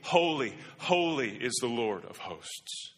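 high ringing call of holy, holy; crying out holy. Retold or imitated. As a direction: call it out like a herald. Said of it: "he's crying out".

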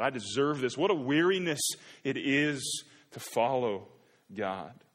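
high and loud, dragging the weariness; put-upon mock groan; entitled whine. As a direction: complain as if owed something. Voicing entitled people.

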